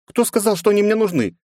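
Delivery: put-on high young girl voice; girlish voice. A narrator's reading. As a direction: pitch up as a pouty teen. Leni's voice in narration.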